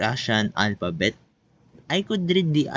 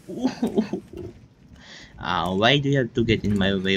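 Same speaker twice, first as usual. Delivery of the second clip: ordinary talk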